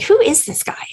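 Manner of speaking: hushed, wondering whisper; amazed whisper. Retold or imitated. Recalling first reading the script, an amazed whisper. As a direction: breathe it out in awe. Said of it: "And I was like".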